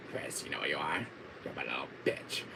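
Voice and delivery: low, soft villain taunt; quiet, deep-pitched mock menace; Wesker. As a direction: imitate a villain's hushed put-down. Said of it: "D C Douglas saying that line".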